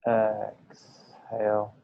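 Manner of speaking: word stretched out very slowly; slow breath cue. Real, not acted. Teacher guiding the class's breathing, very slow cue. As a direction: draw it out for the length of a breath.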